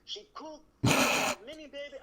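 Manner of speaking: imitating an insistent foreign father; pushy father voice. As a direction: push hard, like a father selling a match. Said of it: "he was not taking no".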